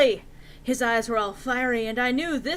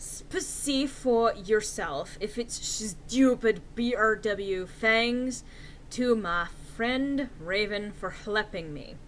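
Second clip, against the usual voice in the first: usual speaking voice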